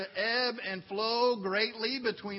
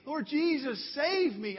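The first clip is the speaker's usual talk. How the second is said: higher, pleading cry to Jesus; fervent cry; 8 semitones higher, 4 dB louder than usual. Acted. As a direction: lift it up like a real cry for rescue. Crying out and saying.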